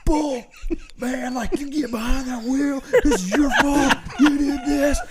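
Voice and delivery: Billy blaming the driver, high and laughing; imitating Billy. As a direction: cackle out the blame. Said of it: "And Billy's like".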